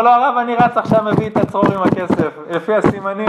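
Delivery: a man bursting to prove his guilt; excited blurting, high and loud; eager rush. Retold or imitated. Thief rushes to prove it.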